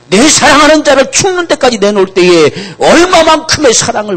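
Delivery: very high, loud fervent preaching; preaching at full force. Acted. Preacher tells the congregation of the Father's sacrifice, high and loud. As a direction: drive the point home at full voice.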